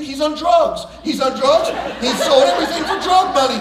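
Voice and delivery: loud half-laughing alarm; hammed-up paranoid dad; mock panic. Dad imagining his son is on drugs, loud.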